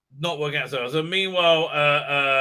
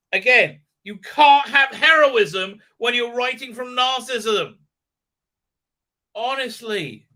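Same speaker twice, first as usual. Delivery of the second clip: high, emphatic indignation; heated, high-pitched verdict; exasperated rant; 8 semitones higher, 6 dB louder than usual. Real, not acted. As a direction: declare it loudly, fed up. Exasperated rant about the writing.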